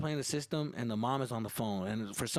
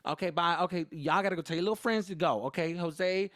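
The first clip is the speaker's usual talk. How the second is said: higher, louder, insistent dismissal; fed-up mom; 4 semitones higher, 4 dB louder than usual. Retold or imitated. Mom sends her son's little friends home, high and firm. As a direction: shoo everyone out as a fed-up mom.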